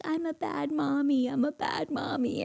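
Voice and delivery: high-pitched whiny imitation of a daughter; put-on tearful child voice; mock sobbing child. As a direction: wail it lightly like an upset little kid. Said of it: "now she's sobbing".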